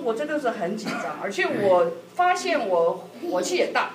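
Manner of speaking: normal talking voice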